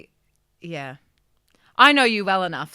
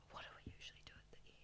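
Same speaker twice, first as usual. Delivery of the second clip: fast, hushed whisper; barely audible whispered question; whispered aside; 35 dB quieter, much faster than usual. Real, not acted. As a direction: whisper it quickly to your co-host. Aside to co-host, forgot the ending.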